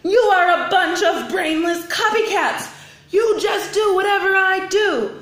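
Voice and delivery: laughing yell. Acted. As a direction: shout it at the copycats, cracking up. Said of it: "Stephanie yelled".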